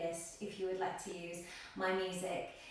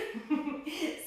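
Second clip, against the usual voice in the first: short, higher-pitched chuckle; light laugh; 9 semitones higher, 4 dB louder than usual. Real, not acted. Teacher finishing her housekeeping for the class, light laugh. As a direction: let out a quick giggle.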